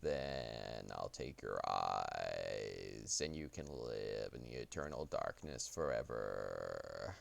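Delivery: deep, slow Grim Reaper voice; drawn-out ominous reaper menace; Reaper impression. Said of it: "a Reaper impression".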